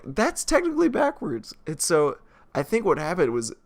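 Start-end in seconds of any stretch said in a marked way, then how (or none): 0.0-1.5 high nitpicking voice; lightly mock a know-it-all viewer; imitating a pedant. Theater viewer points out the backwards screen, high pedantic voice. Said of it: "they were like".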